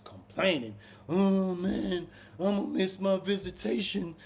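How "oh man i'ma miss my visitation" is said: higher, mock-whiny big guy; hammed-up complaint about missing his visit; whining. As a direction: imitate a grown man whining. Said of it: "He just kept whining".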